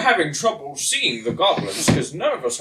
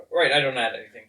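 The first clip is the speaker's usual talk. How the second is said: ordinary delivery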